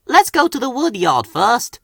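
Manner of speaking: cheerful and hurried; puffed to Flora. A narrator's reading. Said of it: "Thomas puffed to Flora".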